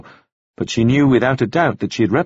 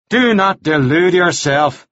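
slow, raised-pitch warning; stern rebuke; 6 semitones higher, slower than usual. A narrator's reading. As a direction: scold her slowly and indignantly. Devlin scolds Anna in quarrel.

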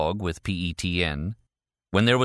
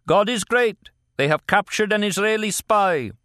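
much higher, louder call across the quarry; yelled; 13 semitones higher, 4 dB louder than usual. A narrator's reading. Man calling down to the quarry with hands cupped, yelling. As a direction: shout the news to the men far below. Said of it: "cupping his hands around his mouth, yelled".

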